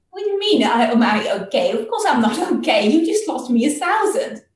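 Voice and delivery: high, loud indignant complaint; upset outburst. Acted. Brahmin venting at the bull after losing money, loud and indignant. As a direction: vent the loss at the bull.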